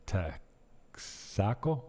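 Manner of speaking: TEXACO drawn out slowly, stress moved to SA; local pronunciation. Shown as a demonstration. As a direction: sound out TEXACO as tek-SA-co, questioning. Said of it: "they put the accent on the end".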